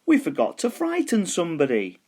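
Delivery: high, eager skeleton; a skeleton keen for a scare; big skeleton voice. A narrator's reading. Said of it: "he said".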